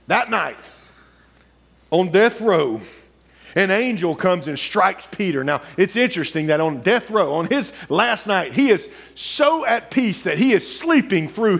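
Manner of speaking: high, ringing sermon storytelling; preaching at full force. Acted. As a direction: drive the Bible story to the church. Preached retelling of Peter story.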